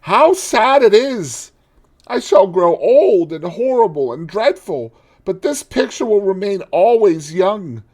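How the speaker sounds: high, loud despairing cry; anguished lament. Acted. Dorian lamenting to the painter, high and anguished. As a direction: pour out his despair, pleading.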